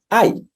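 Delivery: high, pained ay; a short cry of pain. Shown as a demonstration. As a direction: yelp it like it hurts. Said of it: "los ayes son dolor".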